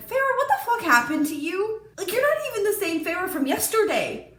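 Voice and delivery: scolding the character, half-joking; what happened to you, exasperated. Retold or imitated.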